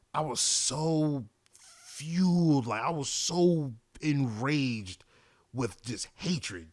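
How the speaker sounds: drawn-out, simmering anger; slow and bitter, reliving the fury; slow seething. Real, not acted. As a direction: drag the words out like the rage is still there. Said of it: "describing his rage".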